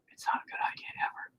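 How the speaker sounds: voiceless, fast whispered aside. Real, not acted. To himself about staying behind, in a quick whisper. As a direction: breathe it out quickly under the breath.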